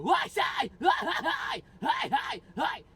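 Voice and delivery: high yelled screams of hi; high pitched yelling scream. Retold or imitated. Imitating the Of Mice and Men singer's high scream. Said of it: "this high pitch and yelling".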